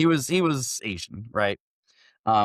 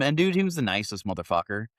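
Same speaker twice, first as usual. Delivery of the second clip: usual speaking voice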